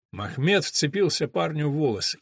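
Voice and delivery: plain reading voice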